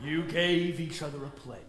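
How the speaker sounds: low, hushed question; deep, quiet and shaken; stunned disbelief. Acted. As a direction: repeat it softly as the shock lands. Father stunned by the news.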